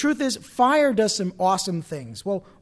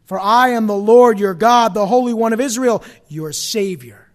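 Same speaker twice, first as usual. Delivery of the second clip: loud, higher preaching voice; scripture proclamation; 4 semitones higher, 10 dB louder than usual. A narrator's reading. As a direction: proclaim the promise loudly, drawing out key phrases.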